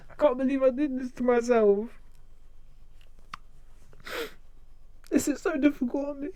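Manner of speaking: mock anguish. Playful lament over own accent.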